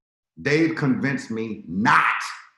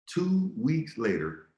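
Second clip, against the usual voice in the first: slow; 5 semitones higher, 3 dB quieter, much slower than usual. Shown as a demonstration.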